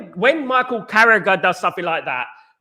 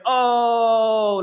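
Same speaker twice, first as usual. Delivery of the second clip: imitating Gary Neville; 4 semitones higher than usual. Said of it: "like that".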